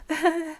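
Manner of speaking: a short high nervous giggle; nervous laugh. Acted. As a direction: laugh it off uneasily. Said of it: "with a nervous laugh".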